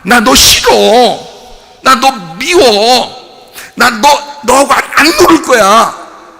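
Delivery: loud, high-pitched defiance; defiant refusal. Acted. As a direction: shout it off like rejecting a playmate. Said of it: "이랬단 얘기예요".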